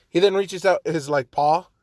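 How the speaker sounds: usual speaking voice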